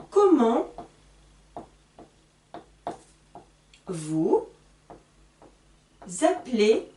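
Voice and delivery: words split by long pauses; slow dictation. Shown as a demonstration. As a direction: dictate word by word, slowly. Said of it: "la même question comme celle-ci".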